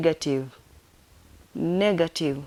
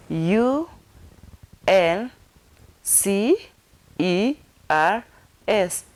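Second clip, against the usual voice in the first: spelled out; much slower than usual. Shown as a demonstration. Teacher finger-spelling ulcers for learners, spelled out. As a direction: separate letters with long pauses. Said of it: "let me finger spell it".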